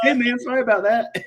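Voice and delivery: high-pitched mock apology; retold called-out apology. Retold apology called out mid-match.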